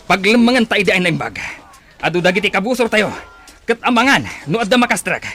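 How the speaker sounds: loud, high commands in battle; shouted orders. Acted. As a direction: bark orders over the clash. Chief shouts during fight. (pukkaw a bilin iti gubat)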